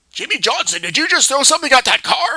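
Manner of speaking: loud, stern bus driver demanding an answer; bus driver scolding. Acted. Bus driver confronts Jimmy about the thrown sneaker, loud and stern. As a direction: call out sternly like a driver who saw it all. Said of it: "Mister School Bus Driver goes".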